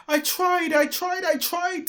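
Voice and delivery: high, loud despairing cry. A narrator's reading. Dilly collapses hopeless, high loud crying out. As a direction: wail that you tried your best. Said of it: "Dilly cried".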